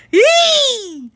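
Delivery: high loud yee; loud laughing whoop; delighted squeal. Real, not acted.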